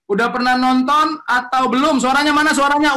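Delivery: rallying the audience loudly; calling out to the audience. Real, not acted. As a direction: shout out to get the crowd responding. Host calling out to webinar audience.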